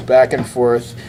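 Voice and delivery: usual speaking voice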